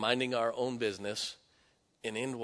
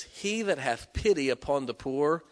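scripture reading; 7 dB louder, slower than usual. Acted. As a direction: read the verse with weight, letting it fade. Reads Proverbs verse from pulpit.

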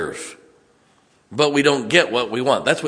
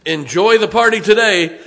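laughing it out, higher and louder; 8 semitones higher, 6 dB louder than usual. Real, not acted. Preacher jokes to graduates, laughing. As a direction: chuckle through the line.

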